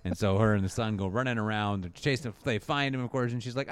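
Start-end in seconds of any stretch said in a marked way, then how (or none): none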